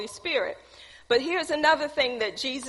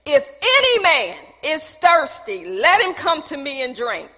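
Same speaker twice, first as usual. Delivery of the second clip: raised voice, easing toward the end; crying out; 3 semitones higher, 5 dB louder than usual. Acted. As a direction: proclaim it out loud to a crowd. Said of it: "he's crying out in a loud voice".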